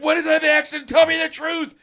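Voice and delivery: high, loud interrogation; imitated furious dad. Dad demanding truth from son, loud mock fury. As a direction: demand a confession at a shout.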